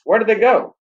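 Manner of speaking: high, loud startled call. A narrator's reading. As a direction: call out loudly in surprise. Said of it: "he said in a loud voice".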